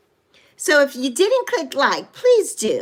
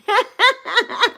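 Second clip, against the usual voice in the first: high, playful laughter; teasing laugh; 7 semitones higher than usual. Real, not acted. Streamer just playing with Tamelia, teasing laugh. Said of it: "I'm teasing".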